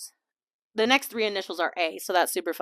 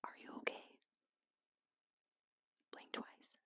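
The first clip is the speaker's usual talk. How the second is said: breathy mock-concerned whisper; joking whisper; 27 dB quieter than usual. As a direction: lean in and whisper the joke. Joking aside to listener.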